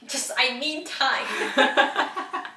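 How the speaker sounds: drawn-out, overwrought plea with a laugh; mock upset. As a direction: stretch it out like a hammed-up meltdown. Acting out own upset reply.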